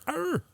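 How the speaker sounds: high, short "arr" grunt; Marine grunt. Real, not acted. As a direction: bark out a Marine-style cry. Marine-style growl between veterans.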